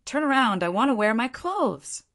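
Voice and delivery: high-pitched, mildly flustered command; hurried. A narrator's reading. Girl asks him to turn around while she dresses, mildly hurried. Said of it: "said in a hurry".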